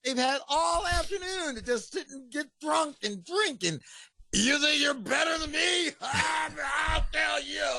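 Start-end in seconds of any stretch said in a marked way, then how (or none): 1.6-7.7 much higher, mock belligerent drunk; drunk fan. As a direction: pick a fight like a drunk fan. Imitates drunk fan picking fight.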